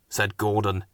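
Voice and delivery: plain reading voice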